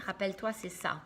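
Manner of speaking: ordinary delivery, nothing marked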